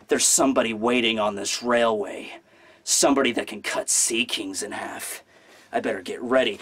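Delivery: low, brooding anime swordsman voice; ominous swordsman. Acted. Acts out Zoro's ominous moment.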